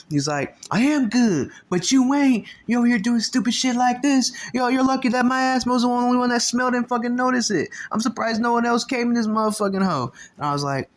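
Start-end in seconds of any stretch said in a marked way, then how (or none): 0.7-10.1 high, loud ranting scold; angry lecture. Retold or imitated. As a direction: keep yelling at the dumb kid. OG lecturing him angrily.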